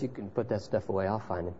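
soft and fast, almost under the breath; quiet, quick assurance. Acted. Son of God telling the family to stop dragging, quietly. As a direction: calmly wave the searchers off. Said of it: "And He walks up and says".

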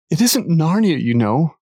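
put-on high, tearful child's plea; a touch of tears, pitched high; sobbing. A narrator's reading. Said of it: "sobbed Lucy".

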